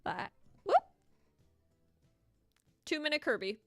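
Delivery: usual speaking voice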